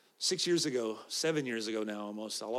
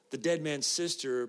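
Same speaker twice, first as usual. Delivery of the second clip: usual speaking voice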